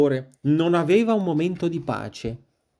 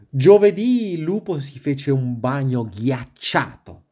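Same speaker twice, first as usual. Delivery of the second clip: usual speaking voice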